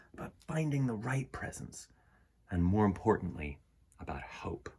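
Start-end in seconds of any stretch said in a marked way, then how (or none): 4.0-4.7 soft, unvoiced whisper; hushed, softer whisper. Real, not acted. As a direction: whisper the word hope tenderly. Tender emphasis in reflection.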